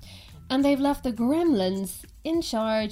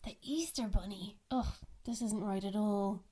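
whispered surprise; 2 semitones lower, 10 dB quieter than usual. Real, not acted. Caller surprised by wrong line.

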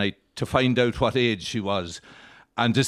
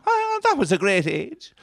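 sympathetic mourner; 10 semitones higher than usual. Acted. Mourner consoling at wake.

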